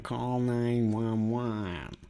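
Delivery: nine one one said very slowly; slow emphatic. Shown as a demonstration. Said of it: "Something's going wrong".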